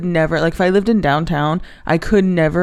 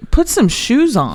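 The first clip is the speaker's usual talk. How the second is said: slow, higher bossy dog voice; 6 semitones higher, slower than usual. Pretend. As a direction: lay down the law like a snooty pup. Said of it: "My dog would say".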